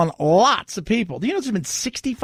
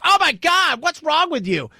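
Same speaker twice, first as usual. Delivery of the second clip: mock outrage; 9 semitones higher than usual. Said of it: "I thought he was gonna do something like".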